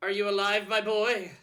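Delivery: high, loud call to someone lying unconscious. Acted. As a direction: shout to check they're alive. Game character checks on fallen player.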